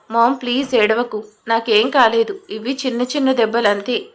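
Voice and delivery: ordinary delivery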